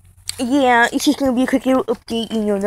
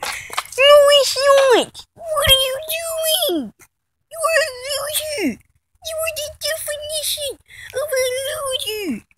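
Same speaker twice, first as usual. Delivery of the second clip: squeaky, much higher put-on voice; high-pitched mock scolding; 13 semitones higher than usual. Vlogger berating a loser, mock scolding in a squeal. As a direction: stretch out the insults in a high squeal.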